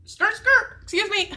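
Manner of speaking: high, loud, hammed-up butting in; pointed mock interruption. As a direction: cut in with a squeal, playfully indignant. Acts out Blake's interrupting reaction.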